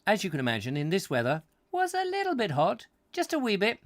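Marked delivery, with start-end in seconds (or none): 1.7-3.8 high-pitched comic understatement; squeaky understatement. Acted. Telling viewers the jumper was hot, in a high squeaky understatement. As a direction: squeak it out as a joke.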